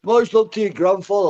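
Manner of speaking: high, loud jeering; loud taunting. Real, not acted. Mocking a rival's dead grandfather, loud jeering. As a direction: jeer at him to wound.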